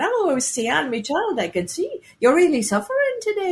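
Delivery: a hammed-up Irish storyteller's lilt; poor Irish accent. Storyteller soothing a girl in pain, in a poor Irish accent. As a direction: soothe a sick child in a put-on Irish brogue. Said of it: "poor Irish accent".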